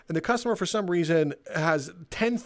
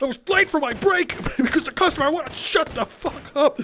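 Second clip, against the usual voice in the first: high, loud ranting yell; acted angry outburst; 10 semitones higher, 4 dB louder than usual. Employee answering manager about late break, acted furious yell. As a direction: blow up at the boss in a raised voice.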